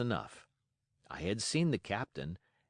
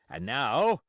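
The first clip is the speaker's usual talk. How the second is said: aloud again; 3 semitones higher, 7 dB louder than usual. Acted. Silver turning from the aside to talk aloud. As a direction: back to full voice.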